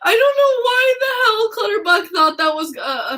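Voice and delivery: high, breaking into laughter; can barely get it out for laughing. Real, not acted. Fan retelling the joke while laughing hard.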